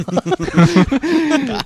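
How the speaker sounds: high, bright burst of laughter; amused laugh. Real, not acted. Telling the listener, laughing at his own cheek.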